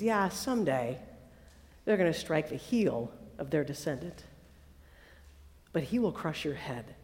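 very low, soft pronouncement; quiet threat. Acted. God passing sentence on the serpent, quietly threatening.

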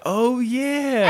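high, drawn-out reminder to oneself; sudden realization. Retold or imitated. Viewer who forgot Joe was pregnant, suddenly remembering.

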